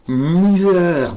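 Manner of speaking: high, loud groan of despair; exasperated cry. Real, not acted. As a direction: cry out Misère in comic despair. Exasperated at puzzle. (cri d'exaspération aigu et fort)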